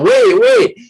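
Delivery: high, loud yell to catch a bus; shouted "Wait, wait" to stop a bus. Shown as a demonstration. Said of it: "if they yell out".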